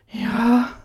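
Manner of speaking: high loud moaned yes. A narrator's reading. Bound woman answering her lover, moaned yes. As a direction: let the yes come out as a moan. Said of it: "Ich stöhne auf und hauche".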